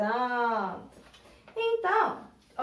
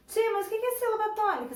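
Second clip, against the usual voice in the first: higher, quick mock pupil asking; imitated pupil question; 7 semitones higher, much faster than usual. Teacher mimicking a pupil asking about tonic syllables, quick higher voice. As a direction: play the curious pupil, fast and higher.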